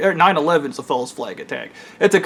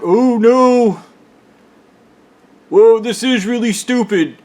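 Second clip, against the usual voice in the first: higher and louder mock startled gunman; mock sudden realization; 6 semitones higher, 4 dB louder than usual. Gunman backing off after realizing his threat is stupid, mock startled. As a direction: ham up the gunman suddenly seeing how dumb this is. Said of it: "And then he's like".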